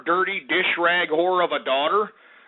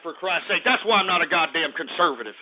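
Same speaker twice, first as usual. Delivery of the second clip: ordinary talk, nothing marked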